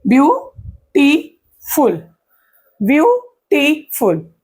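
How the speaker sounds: "Beautiful" slowly in three beats, view, टी, फुल; split into syllables. Shown as a demonstration. Teacher counting three syllables for students, beautiful broken in three. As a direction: pause after each syllable. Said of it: "इसमें तीन syllable है".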